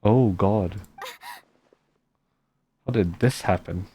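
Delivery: ordinary talk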